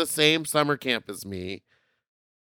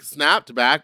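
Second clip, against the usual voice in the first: ordinary talk, nothing marked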